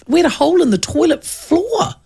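usual speaking voice

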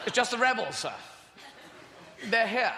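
lightly voiced junior officer reporting; a hint of a jittery subordinate; mock nervous report; nervous British lieutenant. Acted Death Star officers.

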